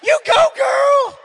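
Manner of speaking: high, drawn-out shouted cheer; excited cheer. Retold or imitated. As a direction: whoop it out at full voice like an excited supporter. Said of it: "You wanna talk about excited Jamie?".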